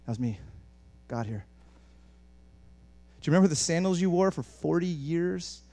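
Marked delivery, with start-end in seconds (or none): 0.0-1.5 low, soft, offhand God voice; understated reveal. Acted. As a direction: drop down and say it modestly. God's understated punchline.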